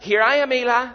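acted boy answering a summons; eager calling out. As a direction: call out to report for duty.

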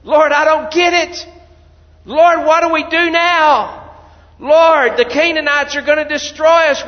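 loud, high desperate plea to God; anguished crying out. Acted. Joshua fallen to the ground, crying out to God, loud and desperate. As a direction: cry out to the Lord in despair. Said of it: "you see how uncertain he is".

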